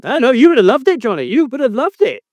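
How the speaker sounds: high, mocking retort; indignant sarcasm. Real, not acted. Host retorts to co-host's wish, sarcastically indignant.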